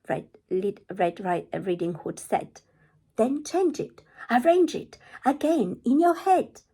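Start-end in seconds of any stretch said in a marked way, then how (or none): 3.2-6.6 put-on high, loud insistent girl; Red's high voice. Red telling the wolf stories can change, high and loud. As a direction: lift it high and loud to make a point.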